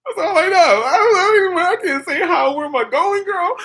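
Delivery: very high, wailing helplessness; hammed-up whine, almost crying; mock panic. Lost in France with two French words, high mock-panicked wail.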